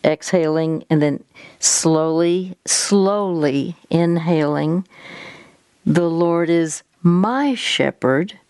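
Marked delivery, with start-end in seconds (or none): 5.8-8.4 MY lifted and drawn out, slow phrase; stress on MY. Shown as a demonstration. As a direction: slowly lean on the word "my". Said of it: "you emphasize a different word".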